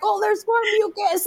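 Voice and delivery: high-pitched mock excitement; excited husband. Husband watching the birth, imitated high excited exclamation. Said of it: "do it again".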